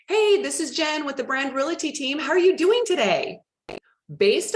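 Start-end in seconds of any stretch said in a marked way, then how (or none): 0.1-3.4 bright, high, bubbly sales greeting; cheery cold call opener. Retold or imitated. Agent opening a cold call, bubbly. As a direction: sound upbeat and friendly. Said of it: "that person that's also like".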